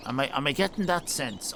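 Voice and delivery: usual speaking voice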